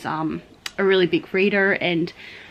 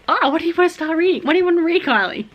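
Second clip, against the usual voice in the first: high, fast, eager question; gushing with excitement over books; excited sister; 10 semitones higher, 4 dB louder, faster than usual. Retold or imitated. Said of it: "her eyes just lit up".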